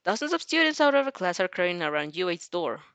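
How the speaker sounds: ordinary delivery, nothing marked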